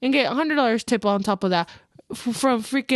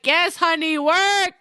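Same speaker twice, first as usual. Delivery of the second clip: higher, sassy cheer; hyping up; 5 semitones higher, slower than usual. Retold or imitated. Stylist cheering a client leaving the chair, hyping up. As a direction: cheer her on like a hype woman.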